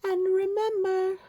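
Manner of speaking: high calling mother rabbit. A narrator's reading. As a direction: call out like Mrs Rabbit. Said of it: "called Missus Rabbit".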